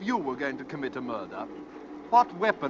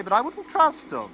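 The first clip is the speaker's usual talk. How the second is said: higher, louder gleeful menace; sinister glee; 12 semitones higher, 5 dB louder than usual. Acted. Stranger gloating about murderous people, higher gleeful menace. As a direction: relish the threat.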